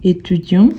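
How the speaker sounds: "étudiant" ending on the nasal vowel, no t; silent final T. Shown as a demonstration. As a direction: drop the t at the end of "étudiant". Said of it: "on ne prononce pas le T à la fin".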